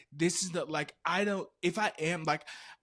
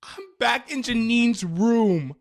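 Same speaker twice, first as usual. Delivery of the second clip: high, very loud, stretched words; dramatic loud cry; 9 semitones higher, 12 dB louder, slower than usual. Retold or imitated. Storyteller thrown back into the room, dramatically loud. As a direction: belt it out slowly in mock horror. Retelling the loop, first big take.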